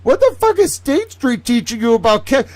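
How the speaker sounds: loud, high, disbelieving rant; incredulous shouting. Real, not acted. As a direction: yell it in total disbelief.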